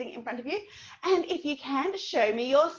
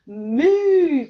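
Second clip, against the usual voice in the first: slow, loud moo; 9 dB louder than usual. Shown as a demonstration. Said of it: "our sign for cow".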